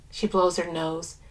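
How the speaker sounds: ordinary delivery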